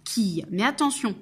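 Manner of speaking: ordinary talk, nothing marked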